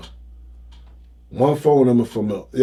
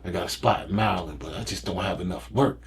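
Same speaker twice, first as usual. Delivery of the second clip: much lower, softer quoted voice; low-key proposition; 9 semitones lower, 14 dB quieter than usual. Retold or imitated. A man offering his Maryland spot, quiet and low-key. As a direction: retell a discreet offer under your voice.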